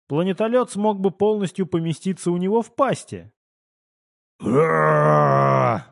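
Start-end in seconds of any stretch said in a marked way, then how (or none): 4.4-5.8 long, loud growling bellow; drawn-out bestial roar; monster roar. A narrator's reading. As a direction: roar like a huge beast. Monster roars before the command.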